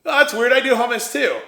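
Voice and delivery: high, loud put-on surprise; mock surprised rival. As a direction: ham up the rival's fake amazement. Rival hummus maker mocked.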